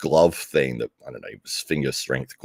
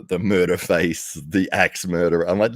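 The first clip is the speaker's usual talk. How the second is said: ordinary talk, nothing marked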